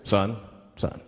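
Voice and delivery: low, measured mock policeman; police officer. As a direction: question the driver in a deep cop voice. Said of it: "the police pull me over".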